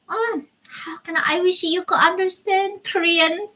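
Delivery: a helpless, wishful sigh of frustration; exasperated plea. Real, not acted. Struggling to explain accents.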